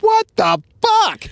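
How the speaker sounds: high, startled mock cry; shocked outburst. Chief spotting snake on suspect's arm, shocked high cry. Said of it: "the chief was like".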